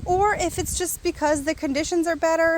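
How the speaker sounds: ordinary talk, nothing marked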